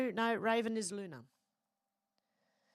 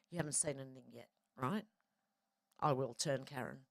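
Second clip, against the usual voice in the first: ordinary delivery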